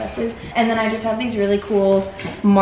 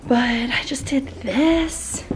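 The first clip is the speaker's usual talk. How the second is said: whispering; 3 semitones higher, slower than usual. Real, not acted. Whispered aside about decor.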